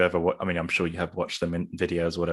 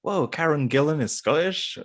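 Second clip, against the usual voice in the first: high, amazed mimicry; mock astonishment; 8 semitones higher, 3 dB louder than usual. Host quoting a comment read just today, mock astonished. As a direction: imitate a commenter's startled discovery.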